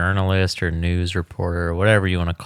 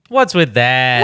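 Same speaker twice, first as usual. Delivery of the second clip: mock show voice; 4 semitones higher, 6 dB louder, slower than usual. Mock reaction to the wrap-up.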